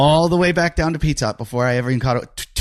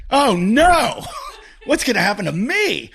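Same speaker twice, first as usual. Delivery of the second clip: comic panic, pitched way up; mock panic; 11 semitones higher than usual. Retold panic watching man swept away.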